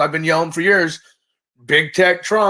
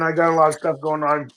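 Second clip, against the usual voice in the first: hoarse, worn-out voice; sick, hoarse throat; 2 semitones lower than usual. Real, not acted. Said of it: "that's why I sound the way I sound".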